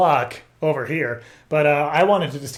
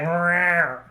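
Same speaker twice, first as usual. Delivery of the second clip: a falling meow that means no thanks; mild cat no; refusing meow; 3 semitones higher than usual. Pretend. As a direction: decline as a cat. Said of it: "I didn't want it".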